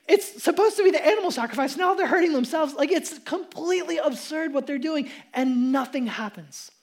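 ordinary talk, nothing marked

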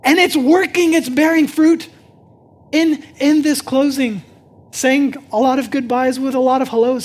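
loud, rising sermon voice, slowing on key phrases; full-force preaching. Acted. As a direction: preach it out to the whole room.

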